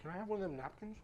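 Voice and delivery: chewing while talking; talking through a mouthful; mouth full. Real, not acted. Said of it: "I'm talking with my mouth full of food".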